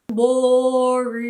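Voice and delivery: loud mocking read of the word; scornful quote. Real, not acted. Quoting the reviewer's verdict, loud and scornful. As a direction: sneer the insult back.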